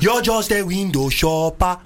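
put-on slow, heavy Nigerian accent; full Nigerian accent scolding. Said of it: "in a Nigerian accent".

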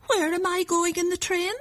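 put-on high, small child's voice; little voice. A narrator's reading. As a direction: pipe up as a little boy asking. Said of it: "said a little voice".

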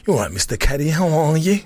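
pretending to be someone else on the phone; a put-on phone greeting, lilting up at the end. Friend bluffing his mate's dad on the phone, put-on voice. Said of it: "put on this voice".

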